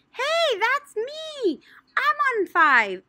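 high, loud, slow shout; boy's shout. Acted. Red-haired boy shouting that he won. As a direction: yell out your win, drawn out. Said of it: "shouted a boy with red hair".

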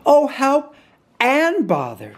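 high-pitched storybook fretting; exasperated dismay. A narrator's reading. Pooh stuck halfway out, fretting in dismay.

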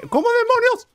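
high loud startled cry; startled shout. Real, not acted. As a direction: blurt it out in shock. Baby escaped the crib.

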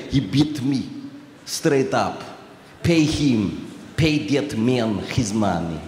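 low, slow Russian mobster voice; Teddy KGB Russian accent. Retold or imitated. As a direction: drag out "pay that man his money" in a thick Russian accent. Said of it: "I do this character from this movie called, uh, Rounders, called Teddy K G B".

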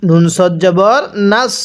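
normal talking voice

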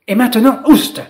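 sharp high shout of oust; shooing her out. Acted. Spider ordering Lila out of its bubble, angry shout. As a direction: shoo the intruder away, loud.

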